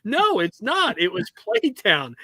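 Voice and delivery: high, exasperated mock rant; disbelieving son scoffing; incredulous self. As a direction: scoff at the silly name. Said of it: "I'm like".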